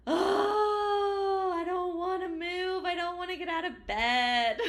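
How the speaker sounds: put-on high, loud, sing-song whine of kids who won't get up; high mock whine; whiny kids. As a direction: whine like a sleepy child refusing to get out of bed. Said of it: "Everybody was like".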